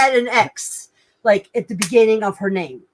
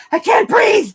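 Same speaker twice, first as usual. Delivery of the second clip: retold panic; 11 semitones higher, 4 dB louder than usual. Retold panic after glowing hand.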